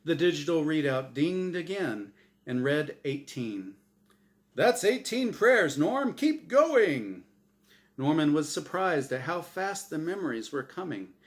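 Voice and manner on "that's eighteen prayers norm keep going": loud, high, cheery host voice; game show host. Acted. Game show host Azrael cheers Norm on, loud and bright. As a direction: boom it out like a TV emcee.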